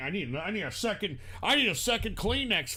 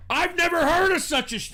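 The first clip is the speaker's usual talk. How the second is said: loud; 7 semitones higher, 5 dB louder than usual. Real, not acted. Indignant rant.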